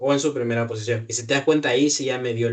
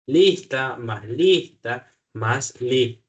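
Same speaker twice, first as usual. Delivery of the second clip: slow, even reading of the code; slow dictation; much slower than usual. Shown as a demonstration. Teacher reading code to a student, slow and deliberate. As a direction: spell it out word by word, slowly.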